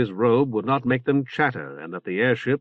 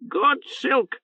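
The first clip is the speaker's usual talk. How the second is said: high croaking bird squawk; hoarse bird voice; 13 semitones higher than usual. Acted. Oreb's bird voice.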